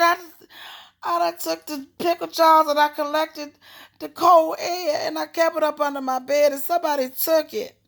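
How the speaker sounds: hammed-up tearful yelling, loud and high; screaming and crying. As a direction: re-enact a kid wailing out a grievance. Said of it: "I'm screaming and crying".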